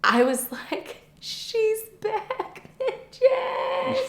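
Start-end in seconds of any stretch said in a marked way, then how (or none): 1.2-4.0 high, cracking victory cry; triumphant squeal. Retold or imitated. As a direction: announce your comeback like a diva. Waking up feeling herself again.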